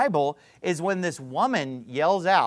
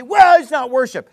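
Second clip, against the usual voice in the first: mock defensive objection; 7 semitones higher, 10 dB louder than usual.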